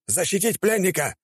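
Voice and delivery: high, commanding call; barked order. Acted. Commander orders guards to protect the prisoner, commanding. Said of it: "приказал он стражникам".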